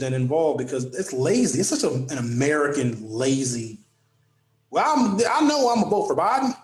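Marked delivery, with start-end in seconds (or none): 4.7-6.5 high-pitched, exaggerated Southern accent; mock Southern accent. Radio host mocking lazy voters, in a put-on Southern accent. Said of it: "everybody I'm mad at has a Southern accent".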